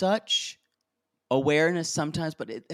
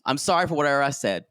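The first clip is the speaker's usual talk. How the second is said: rushed, throwaway sorry; fast careless apology; much faster than usual. Retold or imitated. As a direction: rattle it off carelessly. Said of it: "my father's favorite apologies".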